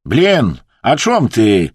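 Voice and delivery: loud, exasperated burst; irritated. A narrator's reading. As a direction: snap out of patience loudly. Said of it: "nie wytrzymał podirytowany Wadim".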